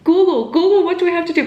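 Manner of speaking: high, loud frantic plea; nervous urgency. Retold or imitated. Nervous runner tells ex to Google, high frantic urging. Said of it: "nervous to Google".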